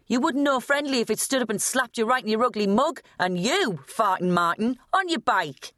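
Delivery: high, sneering put-down voice; scornful teenage girl. Acted. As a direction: voice a scornful girl telling them off. Kerry scornfully tells off the lads.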